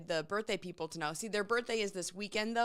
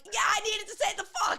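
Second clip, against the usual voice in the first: high, loud mock defiance; hammed-up defiant retort; mock defiant reply; 12 semitones higher, 6 dB louder than usual. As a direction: fire back in a high put-on voice. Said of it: "And I'm like".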